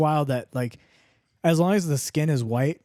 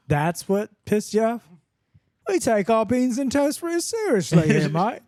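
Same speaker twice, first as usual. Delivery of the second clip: put-on high-pitched British accent slipping into Australian; indignant royal guard, bad British accent; hammed-up, wobbly British-Australian accent; mock British guard; 8 semitones higher than usual. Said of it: "it's like Australian".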